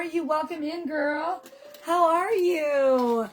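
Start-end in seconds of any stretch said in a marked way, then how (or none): 1.8-3.3 drawn-out greeting. Real, not acted. Greeting a returning viewer on live.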